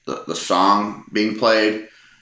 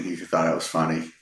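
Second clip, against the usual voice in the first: ordinary delivery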